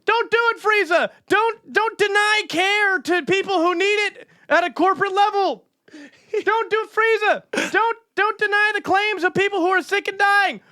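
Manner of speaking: over-the-top cartoon cry; anime hero shout. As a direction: beg the villain at full voice. Anime yell at Frieza.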